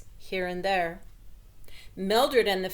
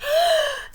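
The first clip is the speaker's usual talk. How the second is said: shocked gasp; 7 dB louder than usual. A narrator's reading. Mother bursts in on messy room.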